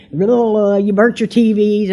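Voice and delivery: ordinary delivery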